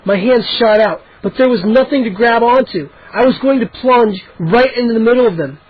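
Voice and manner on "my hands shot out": ordinary delivery, nothing marked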